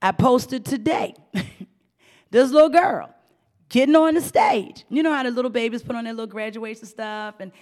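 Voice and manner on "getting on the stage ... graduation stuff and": normal talking voice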